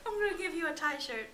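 laughing through the words; giggling while offering. Real, not acted. Joking offer of Thai shirt.

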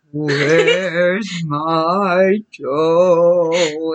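very slow, long-held ghostly groan; eerie monster groan, drawn right out; groaning creature voice. A narrator's reading. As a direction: moan it out, every word stretched. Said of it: "it groaned".

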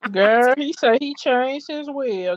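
shocked. Real, not acted. Host reacts to will news.

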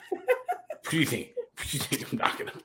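high squeaky laughing question. Real, not acted. Laughing at drunk friend.

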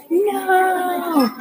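high, drawn-out acted wail; screamed no. A narrator's reading. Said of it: "John screamed at the top of his lungs".

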